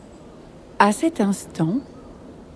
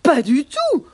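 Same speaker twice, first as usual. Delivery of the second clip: high-pitched indignant denial; protesting giraffes; 6 semitones higher than usual. Acted. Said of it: "protestent les girafes".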